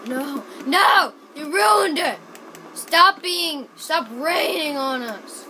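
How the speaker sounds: loud, high protest; loud, high cry of frustration; exasperated yelling. Real, not acted. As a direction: yell in dismay at the game. Yelling at rain in game.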